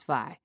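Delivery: "fi" said slowly as one syllable for fire; "fi" with the vowel flattened, no second syllable; Pittsburgh fi. Shown as a demonstration. Narrator demonstrating how Pittsburghers say "fi". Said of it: "Using the wrong vowel sounds".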